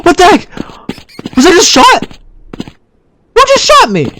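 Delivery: high-pitched, alarmed questioning; shocked disbelief. Real, not acted. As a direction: demand to know, startled and high. Reacting to being shot.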